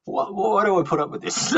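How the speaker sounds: high-pitched words breaking through real laughter; laughing. Real, not acted. Teased Australian guest to his host, laughing in mock exasperation. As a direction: laugh helplessly while protesting.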